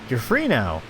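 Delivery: ordinary delivery, nothing marked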